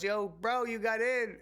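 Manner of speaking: high, loud, excited shout of greeting; excited call. Retold or imitated. Crooked Eye calling out happily to the kid who got in, high and excited. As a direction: call out to him like a delighted friend.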